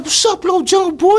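way up high, teasing; high mock detective voice. Storyteller mimicking the detective's teasing greeting, high and mocking. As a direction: pitch it high like a mocking cop.

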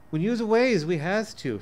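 a small child explaining, lightly played; lightly voiced little girl. Little daughter telling father why they play alone, childlike. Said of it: "she says".

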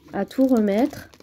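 normal talking voice

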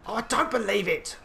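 high, loud disbelief; exasperated outburst. Real, not acted. Gamer just killed again, loud exasperated cry. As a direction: cry out at the injustice.

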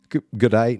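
put-on g'day in a broad Australian accent. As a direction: slip into a bad Australian accent. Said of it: "that's Australian again".

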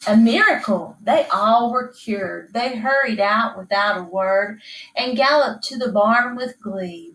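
plain reading voice

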